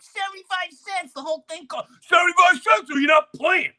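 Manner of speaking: very high outraged shout; indignant disbelief. Retold or imitated. Jeffrey refusing to pay, in a high shocked shout. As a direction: squawk back in shocked outrage.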